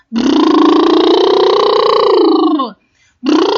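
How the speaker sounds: loud buzzing lip trill. Shown as a demonstration. Teacher demonstrates articulation warm-up, buzzing lip trill. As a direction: blow a lip trill through loose lips. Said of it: "coba latihannya begini ya".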